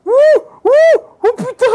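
high startled cry of fear; scared streamer yelp. Real, not acted. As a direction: yelp in sudden panic. Respawns in dangerous spot.

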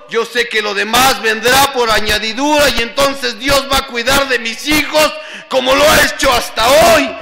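loud, high, fiery preaching; rally the room at full volume, stretching the commands; impassioned testimony turned battle cry; full-force exhortation. Real, not acted.

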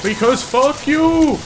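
drawn-out high taunting yell; slow shouted taunt. Real, not acted. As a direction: stretch the taunt out slowly. Repeated taunt, slower.

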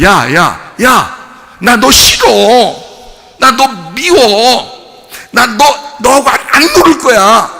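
loud, high shout of rejection; childish shout. Acted. Pastor acting out Paul shaking it off, shouting. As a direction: yell it like a sulky kid pushing someone away. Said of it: "이랬단 얘기예요".

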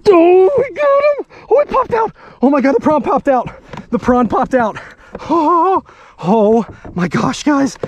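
loud, high-pitched triumphant yelling; ecstatic shouting. Real, not acted. Angler to viewers as fish is landed, ecstatic shouting. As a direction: shout it out, overwhelmed by the catch.

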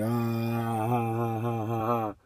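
soft, drawn-out mock laugh; string out a quiet mock cackle of ha-has; imitated laughing. Mimicking the pranksters walking in, mock laughing. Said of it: "they walk in and they're laughing".

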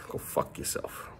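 hushed whisper, voice dropped low; whispered curse. Real, not acted. Kids told not to hear curse.

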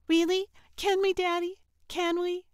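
put-on high, loud, excited little boy; pleading child. A narrator's reading. Carl asking his father to keep the cat, eager pleading. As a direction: beg Dad eagerly in a kid's voice. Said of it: "looking up at his father with pleading eyes".

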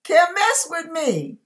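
usual speaking voice

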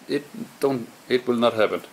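usual speaking voice

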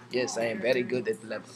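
announced mock-Russian voice; Russian accent. Gamer shows off a promised accent, playful Russian accent. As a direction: slip into a light Russian accent. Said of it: "I'm gonna talk in my Russian accent".

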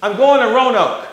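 very high, loud squawking woman's voice; mock shrill old lady. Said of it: "she says".

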